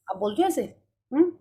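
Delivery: normal talking voice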